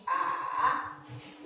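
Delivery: A dipping down and up like a bridge; dipping third tone. Shown as a demonstration. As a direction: bend A low, then up. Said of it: "more like there's a bridge".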